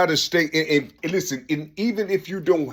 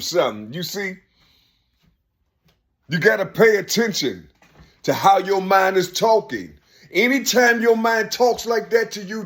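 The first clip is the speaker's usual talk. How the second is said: ordinary talk, nothing marked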